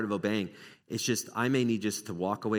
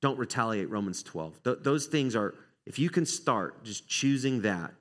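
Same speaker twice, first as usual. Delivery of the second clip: usual speaking voice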